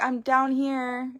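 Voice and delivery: high, slow little voice; child's reply. Retold or imitated. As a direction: answer sweetly from downstairs. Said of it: "And she's like".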